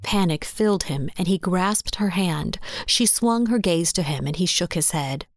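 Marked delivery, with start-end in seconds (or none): none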